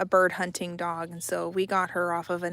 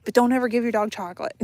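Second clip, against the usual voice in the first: louder and fast, blurting out the warning; quick loud warning; 2 semitones higher, 5 dB louder, much faster than usual. Real, not acted. Talking to viewers, warning loudly and fast about chocolate. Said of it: "repeating warning".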